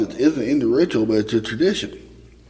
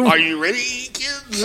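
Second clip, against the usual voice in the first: high, laughing cartoon pirate call; SpongeBob pirate voice; 12 semitones higher than usual. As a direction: belt it like a cartoon sea captain. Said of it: "he sounds like the sea captain on SpongeBob".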